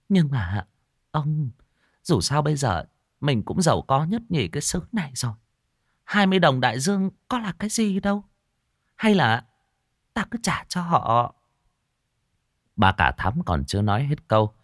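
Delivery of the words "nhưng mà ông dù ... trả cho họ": much higher, quick timid plea; pleading wife. Acted. Wife pleading with husband to repay, higher. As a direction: beg him nervously to pay back.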